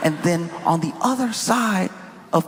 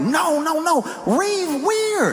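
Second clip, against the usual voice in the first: put-on excited kids arguing; 9 semitones higher than usual. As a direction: hype up your bet like a kid. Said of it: "And we're like".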